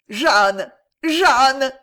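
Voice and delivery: loud, rising howl of her name; anguished howl. A narrator's reading. Maréchal cutting off Jeanne, loud anguished howl. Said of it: "hurla le maréchal".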